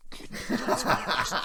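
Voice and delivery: high whispery giggle through the words; breaking into laughter. Pretend. The rumour joke breaks him up, giggling through it. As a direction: laugh it out breathlessly. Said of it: "if I put my ear up against the wall".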